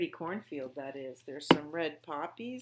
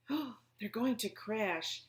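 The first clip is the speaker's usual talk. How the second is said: slow, breathy alarmed gasp; 4 semitones higher, slower than usual. A narrator's reading. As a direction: gasp it out, frightened for friends. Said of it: "gasped Stellaluna".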